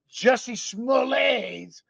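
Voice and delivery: "Smollett's" said French-style as smo-LAYS, drawn out high; mock French accent. Shown as a demonstration. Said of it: "throw the French accent in there".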